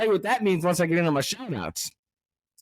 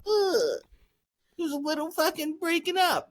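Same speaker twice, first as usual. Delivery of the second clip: high, whiny mock complaint; mock the nitpicking listener in a high whine; mimicking a listener whining about the audio; 11 semitones higher than usual. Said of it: "if I hear one fucking comment".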